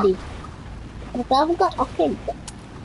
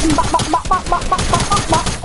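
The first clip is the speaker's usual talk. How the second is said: urgent repeated call, raised pitch; 5 semitones higher, 3 dB louder than usual. Real, not acted. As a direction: shout back back back. Calling teammate back in game.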